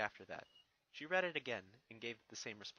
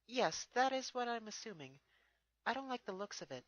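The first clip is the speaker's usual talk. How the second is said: higher, lightly voiced young mare; a thoughtful pony princess, pitched up; Twilight's voice; 10 semitones higher, 3 dB louder than usual. Twilight answers Spike, lightly voiced and higher.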